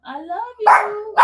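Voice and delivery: high drawn-out pet prompt. Shown as a demonstration. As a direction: call it out slowly for the dog to copy. Prompting dog to repeat it.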